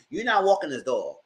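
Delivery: ordinary talk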